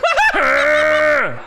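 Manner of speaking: high honking mock laugh; imitated deaf laugh. Comedian imitates how deaf people laugh, loud honk. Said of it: "sounds like they're stomping pigeons".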